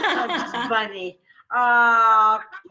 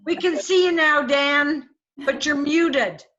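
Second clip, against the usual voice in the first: raised voice, calling across to someone muted; louder, pitched up to get his attention; 3 semitones higher, 4 dB louder than usual. Real, not acted. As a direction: call out so he notices you.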